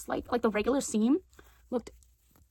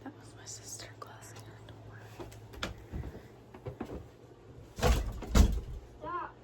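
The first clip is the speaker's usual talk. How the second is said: hushed unvoiced whisper near sister's room; 4 semitones higher, 8 dB quieter than usual. Real, not acted. Teen vlogger whispers to camera as her sister shuts her door, whispering. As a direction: keep it to a breath so she won't hear.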